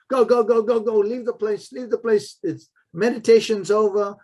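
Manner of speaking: imitating a harsh old guard shooing people out. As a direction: bark at him to leave. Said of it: "And he was very harsh".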